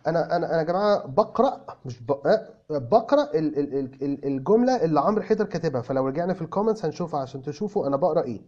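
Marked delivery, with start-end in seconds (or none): none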